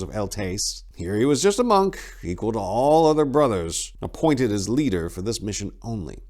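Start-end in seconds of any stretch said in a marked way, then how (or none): none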